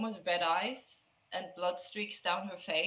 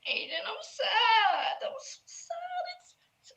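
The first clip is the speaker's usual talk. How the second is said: crying; 14 semitones higher, 3 dB louder than usual. Acted. Crying girl tells Aiden she is sad, high wailing. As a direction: wail it tearfully, then fade to nothing. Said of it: "crying and holding him".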